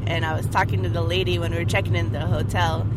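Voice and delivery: usual speaking voice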